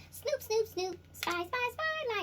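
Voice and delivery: high sing-song mocking of a sneaky spy; mocking sneaky snoop. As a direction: tease them in a high, snooping singsong. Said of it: "and go".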